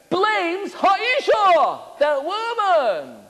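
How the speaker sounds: slow and pointed, leaning on Ha'isha; dragged out slowly. Real, not acted. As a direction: stretch each word of Ha'isha out slowly. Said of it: "slow stressed delivery".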